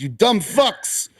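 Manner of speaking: grandma's scolding. Retold or imitated. Grandma scolding at family dinner.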